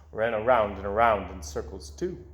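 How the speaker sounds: ordinary delivery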